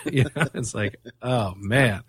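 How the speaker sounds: ordinary talk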